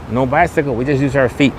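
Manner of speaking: ordinary talk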